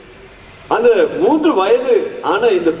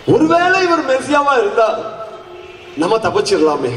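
higher, hopeful musing aloud; eager hope; 5 semitones higher than usual. Acted. Thief wondering if Jesus could save his life, eager. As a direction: think out an escape with eager, lifted pitch. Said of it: "என்று ஒரு ஆவலோடு".

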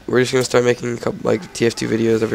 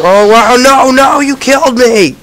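loud, high outraged shouting; 13 semitones higher, 10 dB louder than usual. Real, not acted. Killed in game.

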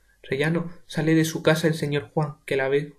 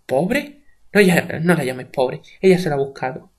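heated; 8 dB louder than usual. Acted. Father refuses pity for Rosita, heated and loud. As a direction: throw the word pobre back at her.

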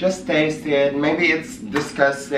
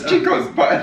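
laughing. Real, not acted. Joking through laughter.

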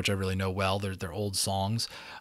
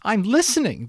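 much higher, louder cry; eager cry; 12 semitones higher, 6 dB louder than usual. A narrator's reading. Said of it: "the unicorn cried".

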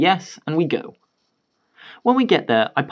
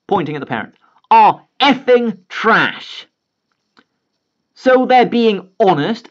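loud, slow, higher angry dad; furious rant; 6 semitones higher, 4 dB louder than usual. Retold or imitated. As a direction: tear into them with every word. Father blows up at parents.

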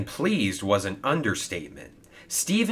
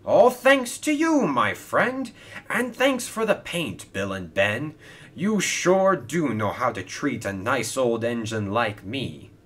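delighted thanks; 3 semitones higher, 6 dB louder than usual. Acted. Old engine thanks his friends for the paint, delighted. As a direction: burst out with joyful gratitude. Stephen delighted, answering Hero's shout.

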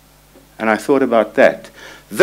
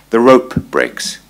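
slow emphasis; slower than usual. Real, not acted. Punchline of the rope metaphor.

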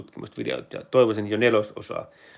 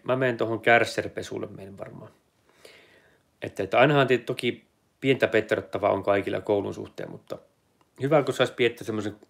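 ordinary delivery

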